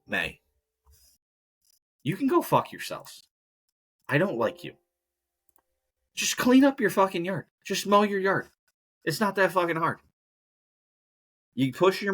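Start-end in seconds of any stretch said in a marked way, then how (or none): 2.0-10.0 higher-pitched exasperated rant. Real, not acted. Vlogger scolds No Mow May people, exasperated rant. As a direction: tear into them, fed up.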